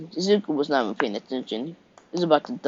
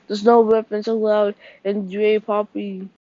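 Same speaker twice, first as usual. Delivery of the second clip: prissy hall monitor; 6 semitones higher, 4 dB louder than usual. Acted. Ida lectures Zuko rushing out.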